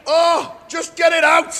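high, loud pleading cry; pained shout. Real, not acted. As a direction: yell it out in real pain. Pulling out his nose hair.

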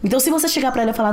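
normal talking voice

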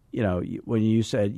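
ordinary talk

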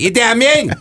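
high-pitched loud name call. Pretend. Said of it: "That's all I'll say to Brooksy".

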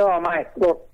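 normal talking voice

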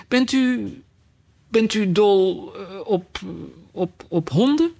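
halting, high, unsure question; hesitant stammer. A narrator's reading. Alice, in a hurry to change the subject, stammers a question to the mouse. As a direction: stumble nervously over the words.

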